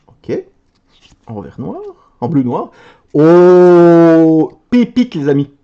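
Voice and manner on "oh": long, loud drawn-out oh; amazed long oh. Real, not acted. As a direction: gasp in awe at the pull. Amazed at a card. (long oh émerveillé et fort)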